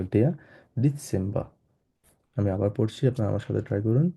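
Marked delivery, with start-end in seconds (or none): 0.8-1.4 ডিসেম্বর said "dit-sem-ba", falling at the end. Shown as a demonstration. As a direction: model ডিসেম্বর with TS in the middle. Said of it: "সেটটা এস এর মতো উচ্চারণ". (ডিসেম্বর, মাঝে "ৎস")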